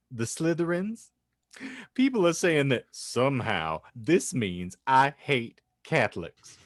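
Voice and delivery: normal talking voice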